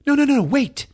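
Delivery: high, urgent pleading; mock panic. Hearing someone walking upstairs, mock panic. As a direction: voice jumping up in hammed-up alarm.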